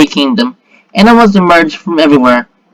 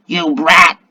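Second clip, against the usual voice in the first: beast growl. A narrator's reading. Beast turns on the girl, mild growl. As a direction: growl it briefly, without much volume. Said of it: "growled Gal".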